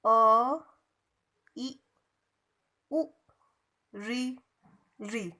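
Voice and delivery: each vowel cut crisp and short: अ, इ, उ, री; short vowel demo. Shown as a demonstration. Teacher showing pupils the short vowels, crisp and brief. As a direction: clip अ इ उ री quickly, one beat each. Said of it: "इसका उच्चारण कैसे किया जाता है".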